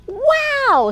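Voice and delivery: owl's wow. Acted. Owl sees fluffy clouds, a very high, loud wow. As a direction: stretch it up in delight. Owl exclaims in picture book.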